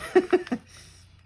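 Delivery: high, airy whispered laugh; breathy laugh. Real, not acted. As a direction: laugh under your breath at yourself. Laughing after calling herself silly.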